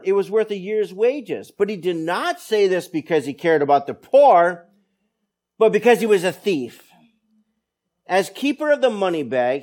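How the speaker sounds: loud, measured Bible reading to the congregation; scripture reading. Acted. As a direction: read the Gospel out with weight. Reads the John passage aloud.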